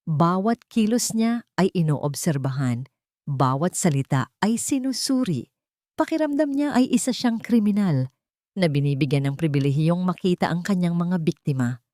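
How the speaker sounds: ordinary delivery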